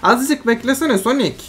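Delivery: high, loud pleading complaint; exasperated protest. Real, not acted. Gamer begging teammate to wait, exasperated. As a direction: scold a teammate who jumped in too early.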